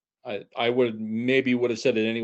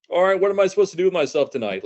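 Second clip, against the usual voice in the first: high, quick, mock-baffled grumble; at a loose end; 10 semitones higher, 6 dB louder, faster than usual. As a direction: play someone lost on a free night, a notch up and fast. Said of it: "you're like".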